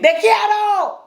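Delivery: high-pitched mock yell in Spanish; yelled affection. Shown as a demonstration. Teacher shows parents what is fine to yell, high yell. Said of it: "what are you yelling".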